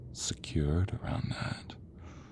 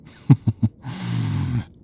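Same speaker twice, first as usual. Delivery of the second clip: drawn-out villain laugh; gloating chuckle; 3 semitones higher, 6 dB louder than usual. Acted. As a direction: chuckle with relish at the captive. Villain relishing the sight.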